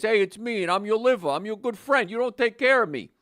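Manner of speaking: high, loud New Jersey wise-guy voice; New Jersey accent. Retold or imitated. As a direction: talk like a Jersey guy playing the liver. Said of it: "apparently your liver's from New Jersey".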